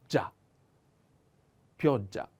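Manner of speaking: gia then pioggia, the gg held strong; strong double consonant. Shown as a demonstration. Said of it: "Is a little strong".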